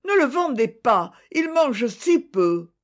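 voix aiguë d'enfant, ralentie sur la fin; fillette suppliante. A narrator's reading. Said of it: "dit la fillette". (la fillette implore sa mère de garder l'ânon, voix aiguë)

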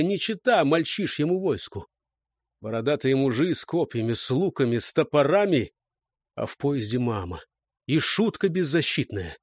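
plain reading voice

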